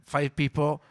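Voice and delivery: normal talking voice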